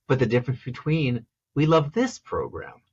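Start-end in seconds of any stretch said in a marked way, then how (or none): 1.5-2.8 only this jumps up in pitch; stress on this. Shown as a demonstration. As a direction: raise just the word this, then fall. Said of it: "just a word".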